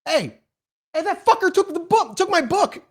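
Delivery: high furious yell at a fleeing thief; angry shout. Acted. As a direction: scream it after the running thief. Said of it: "as he shouts and points".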